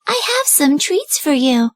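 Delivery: high, slow put-on girlish voice; the wolf sweetly pretending to be the girl; wolf disguising his voice as the little girl; wolf faking a girl. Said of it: "in a high-pitched voice".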